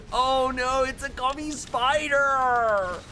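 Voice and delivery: high, loud, slow mock shock; mock surprise. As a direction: act shocked at a gummy spider. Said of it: "retelling the speech".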